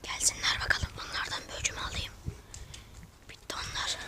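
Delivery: conspiratorial whisper. Real, not acted. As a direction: whisper it behind their backs. Plotting the prank in secret.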